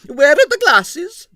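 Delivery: very high, loud, slow demand. A narrator's reading. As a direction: demand it loudly. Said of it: "demanded Arienskaya".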